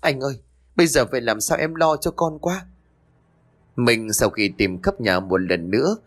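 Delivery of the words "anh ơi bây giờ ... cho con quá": higher, lightly faked tearful wail; fake sobbing. A narrator's reading. Wife faking tears to her husband, mildly faked sobbing. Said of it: "òa khóc".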